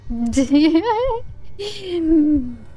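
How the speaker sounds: high cackling laugh. Real, not acted. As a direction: burst out laughing. Laugh after failing.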